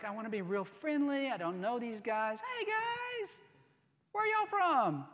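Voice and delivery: high, overly friendly stranger voice; eager friendly Jacob. Acted. Jacob trying to befriend strangers, high and chummy. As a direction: sweeten it up to win them over. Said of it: "you can just hear Jacob like".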